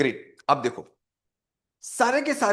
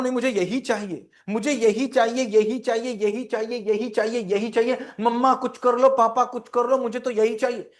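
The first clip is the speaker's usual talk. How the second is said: put-on whiny demanding child; 5 semitones higher than usual. Child begging parents for what it wants, whining. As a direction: nag like a stubborn child who wants it.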